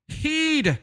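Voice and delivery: "Heed" squealed very high, long ee; shrill high Scottish "Heed"; Scottish accent. Retold or imitated. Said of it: "His dad would always be like".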